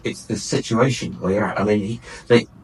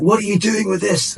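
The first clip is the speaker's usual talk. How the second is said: higher-pitched incredulous customs challenge; acted challenge; 10 semitones higher than usual. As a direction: demand an explanation, pitched up high.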